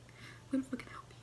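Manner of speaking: soft intense whisper; whispered promise. Acted. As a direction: whisper it close. Whispered repeat.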